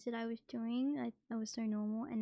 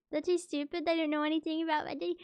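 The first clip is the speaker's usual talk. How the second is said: grumpy pet voice; 7 semitones higher, 7 dB louder, faster than usual. Pretend. Voicing pet's grumpy reply.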